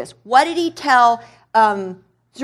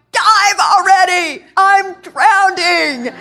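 loud, higher shout, slowed; mock shout; 8 semitones higher, 5 dB louder, much slower than usual. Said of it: "And you're going".

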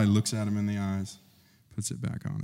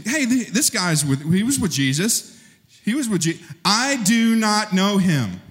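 high, rushed accusation into a flat denial; accuser and denial; 11 semitones higher, 4 dB louder than usual. Acted. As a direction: play both the accuser and Peter. Accuser then Peter's denial.

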